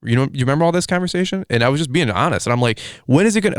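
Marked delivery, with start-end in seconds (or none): 3.0-3.6 high exasperated cry; frustrated outburst. Retold or imitated. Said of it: "I was just frustrated".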